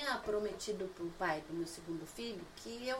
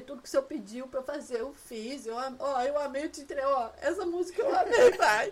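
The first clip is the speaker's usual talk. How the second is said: retold lament climbing higher and louder into a laughing wail; mock tearful plea; 5 semitones higher, 9 dB louder than usual. Woman retelling her lonely New Year prayer to God, mock tearful. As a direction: plead with God in a hammed-up cry. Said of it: "eu ficava chorando, lembrando".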